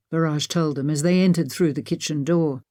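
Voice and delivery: usual speaking voice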